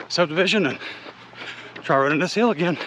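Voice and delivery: normal talking voice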